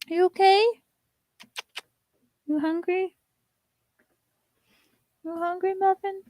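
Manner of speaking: high, sing-song pet voice. Real, not acted. To her dog Muffin, high cooing baby talk. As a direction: coo at the dog.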